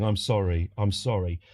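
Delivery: low, meek apology; imitated sad, submissive apology. Barman apologising to an angry customer, sad and submissive. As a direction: mumble it like a downcast barman. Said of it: "he looked really sad".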